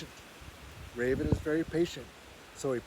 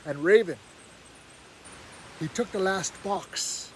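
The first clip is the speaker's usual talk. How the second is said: usual speaking voice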